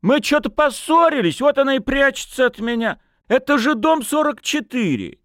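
high, whiny tipsy protest; aggrieved drunken lad; indignant wailing. A narrator's reading. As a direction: argue your case at a high pitch. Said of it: "возмущённо заголосил молодой человек".

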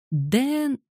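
put-on high child's call broken off mid-name; high, unfinished cry; cut-off call. A narrator's reading. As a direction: start wailing the name, then stop short. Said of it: "она оборвала себя на полуслове".

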